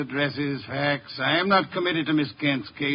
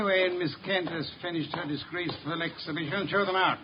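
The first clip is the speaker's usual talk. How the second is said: quiet, disdainful aside; cold dismissal; 8 dB quieter than usual. Acted. Detective dismisses weeping client, coldly quiet. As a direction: dismiss her quietly with contempt.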